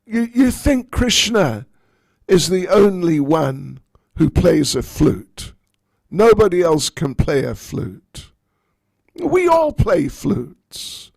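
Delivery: loud, high, scornful retort; mock the old lady's silly question; arrogant. Lalita scoffs at old Jatila about the flute, arrogant and loud. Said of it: "Lalita said very arrogantly".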